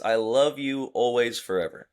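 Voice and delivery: ordinary delivery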